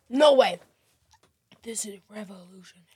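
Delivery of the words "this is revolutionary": whispered "This is revolutionary" with sarcasm; sarcastic whisper. Acted. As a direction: whisper "This is revolutionary" in mock awe. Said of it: "I was being sarcastic".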